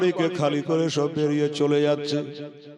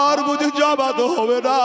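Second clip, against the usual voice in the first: rising lament; 11 semitones higher than usual. Acted. Hussain's lament climbs in pitch.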